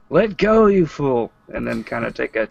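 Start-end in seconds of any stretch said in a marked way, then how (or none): 0.1-1.3 high-pitched shout, dropping on the last words; yelled warning. Acted. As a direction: yell it out in character to someone falling. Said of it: "I suppose I'm gonna yell out".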